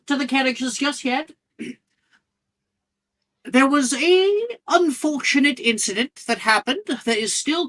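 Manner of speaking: tiny professor calling the students together; Flitwick voice. Acted. Flitwick addresses all students.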